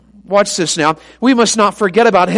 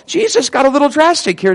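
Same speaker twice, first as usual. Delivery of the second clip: usual speaking voice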